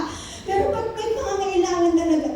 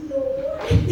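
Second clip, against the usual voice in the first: high, drawn-out mock cry of Lord; anguished cry; 10 semitones higher than usual. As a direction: cry out to God like calling from your room. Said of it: "sisigaw ka ng pagulong".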